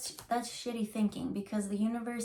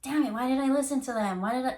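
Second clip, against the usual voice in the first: loud, higher cry of angry regret; frustrated self-blame; 5 semitones higher, 6 dB louder, faster than usual. Retold or imitated. Person regretting listening to others, loud frustrated outburst. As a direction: kick yourself out loud.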